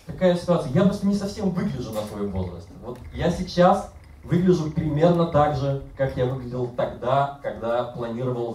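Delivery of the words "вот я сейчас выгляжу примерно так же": ordinary delivery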